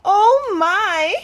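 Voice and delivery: high, loud clucking hen voice. A narrator's reading. Hen startled by the goslings, high clucking squawk. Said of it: "Henny clucked".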